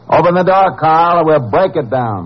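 high, carrying ultimatum; shouted threat. Acted. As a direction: shout a final warning through the door. Threat shouted through locked door.

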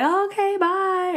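high, singsong fake-polite goodbye; pretend-nice high-pitched farewell; mock brush-off. Imagined brush-off of a date.

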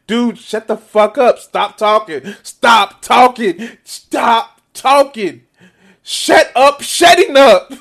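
hammed-up "stop talking" at his guest, dragging it out slowly; imitating Tucker Carlson. Streamer mimics Tucker silencing Gaetz, exasperated mock yell. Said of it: "He went from his confused face to his just like".

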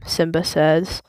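ordinary delivery, nothing marked

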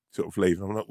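ordinary talk, nothing marked

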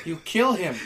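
normal talking voice

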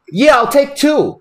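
Spicoli buying energy shots. Retold or imitated. Spicoli at the gas station counter, high, slow and eager. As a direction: drag it out as the keen party guy. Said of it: "on the bottle and goes".

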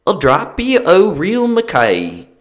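high, loud, drawn-out bad Scottish accent; terrible Scottish accent. Shown as a demonstration. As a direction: belt out a badly faked Scottish accent. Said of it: "an accent that's gonna be terrible".

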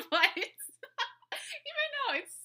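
high, slow words broken by laughing; real laughter. Real, not acted. Host laughing over a listener's funny answer, helpless giggling. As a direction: crack up while trying to get the words out.

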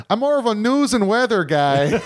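mock Midwest accent. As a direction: imitate a folksy Midwest husband. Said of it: "that real Midwest".

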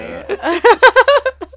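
big high laugh breaking out; loud burst of laughter. Real, not acted. Laughing at [name] throwing candles. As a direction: crack up loudly.

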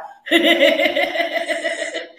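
a long, loud real laugh. Real, not acted. Admitting three out of four, laughing hard.

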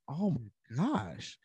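drawn out very slowly in awe; awed surprise. Retold or imitated. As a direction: let it sink in, stunned. Discovering coins in jar.

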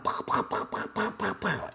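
voiceless popping gun noises; pop-pop shots with the mouth; mimed gunshots. Acted. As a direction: imitate rapid toy gunfire. Imitating gunfire in story.

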